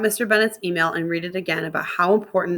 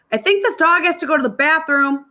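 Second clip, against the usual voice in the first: higher, louder man's voice; raised voice for Bill Marston; voicing Bill Marston; 9 semitones higher, 5 dB louder than usual. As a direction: play the fussy adult, pitched up.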